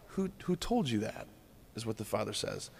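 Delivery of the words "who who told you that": gentle question. Acted. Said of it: "it's gentle".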